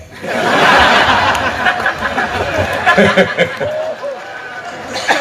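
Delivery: breathy high-pitched laugh at his own joke. Real, not acted. Preacher laughing at his own joke, high laugh. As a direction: let out a long rising laugh.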